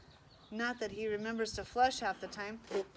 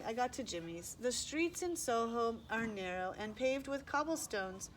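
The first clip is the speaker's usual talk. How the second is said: ordinary delivery, nothing marked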